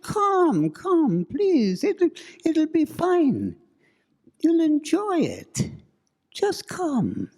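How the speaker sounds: high, loud, pleading eerie coax; coaxing ghost voice. Acted. Ghostly woman coaxes the couple toward the ravine, high and pleading. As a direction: draw them toward the ravine's edge. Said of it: "She said".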